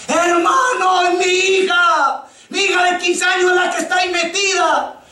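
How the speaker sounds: high anguished shout; grieving father's cry. Retold or imitated. A father shouts his grief at the preacher beside his daughter's coffin. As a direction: cry out over the coffin in despair. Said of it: "ese hombre gritaba".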